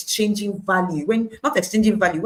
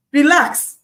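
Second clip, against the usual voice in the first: Relax, said loud and high, falling; 8 semitones higher, 9 dB louder than usual. Shown as a demonstration. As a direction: call out Relax firmly. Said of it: "Say, "Relax".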